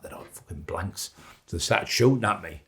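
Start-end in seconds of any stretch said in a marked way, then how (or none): 0.0-1.1 whispered, much softer; whispered thought. Retold or imitated. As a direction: breathe it to yourself under fire. Inner thought while facing guns.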